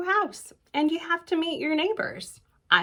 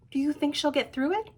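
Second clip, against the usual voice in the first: plain reading voice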